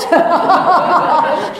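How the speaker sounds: high, loud laugh that rises. Real, not acted. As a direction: break into laughter. Laugh inside quote.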